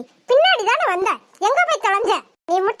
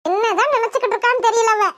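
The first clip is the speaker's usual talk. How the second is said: ordinary talk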